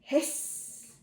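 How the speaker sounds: breathy voiceless hiss; goose hiss. Acted. Goose's line in a farm story, hissing. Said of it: "said the goose".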